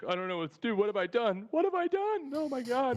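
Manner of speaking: high-pitched hammed-up wail of despair; put-on tearful lament; mock crying. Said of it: "he's just crying at the table".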